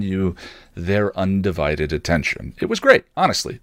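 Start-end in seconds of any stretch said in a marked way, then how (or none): 2.6-3.6 a sudden burst of higher, louder delight; light up as you remember it; enthusiastic. Real, not acted. Looking back on the forum, with real enthusiasm.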